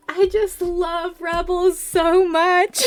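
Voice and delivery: high, loud, slow fangirl wail; gushing wail. Real, not acted. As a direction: wail your love for the show. Fan gushing after fake fan jab.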